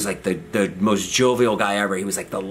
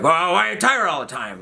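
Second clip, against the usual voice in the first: imitated yelling doctor; 6 semitones higher than usual. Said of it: "he just starts yelling at me".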